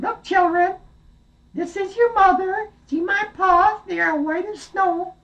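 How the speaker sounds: very high coaxing falsetto; wolf faking mother. Acted. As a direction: lure them out in a squeaky sweet voice. Wolf fakes mother again.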